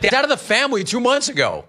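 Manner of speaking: mock neighbors. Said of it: "Quote, like".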